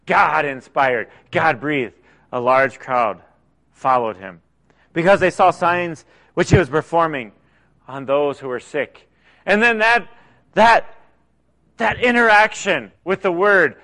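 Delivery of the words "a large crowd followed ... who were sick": low, deliberate reread of the verse; scripture reading. Acted. Preacher rereading the verse to the church, lightly measured. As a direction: savor each phrase as you reread it.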